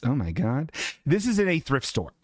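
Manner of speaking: ordinary talk, nothing marked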